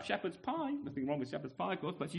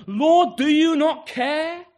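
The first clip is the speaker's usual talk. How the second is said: much louder, higher cry of complaint; exasperated outburst; 6 semitones higher, 11 dB louder, slower than usual. Retold or imitated. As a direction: burst through the door demanding an answer. Martha bursts in on Jesus.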